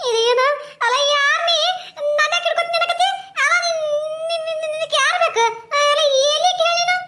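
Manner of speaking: high, whimpering, stammering woman's voice; scared woman. Acted. A frightened woman asking the ghost who it is, high whimpering. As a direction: panicky questions in a high voice.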